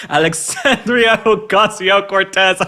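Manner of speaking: high, slow, drawn-out mock spell-casting; mock spell incantation. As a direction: chant it like a Harry Potter spell. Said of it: "It sounds like a spell from Harry Potter".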